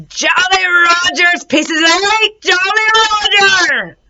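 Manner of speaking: high squawking parrot cry. Acted. Parrot in its cage squawks, high and loud.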